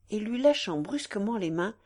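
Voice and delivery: ordinary delivery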